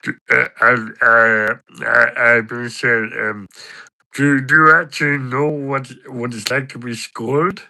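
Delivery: halting, stammering character impression; Patter. Character asks his mate about being scolded, stammering impression. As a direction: put on another man's voice, stumbling into the question. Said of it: "I can do an impression of Patter".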